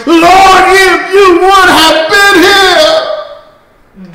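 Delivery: high, slow, pleading lament; slow keening plea, pitched well up; Mary's plea. Retold or imitated. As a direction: plead with the Lord as grieving Mary. Mary pleading with Jesus.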